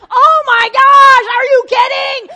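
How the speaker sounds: high, very loud, ecstatic yell; excited shout. Retold or imitated. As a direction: scream it like the game was just won. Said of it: "You want to be like call and response".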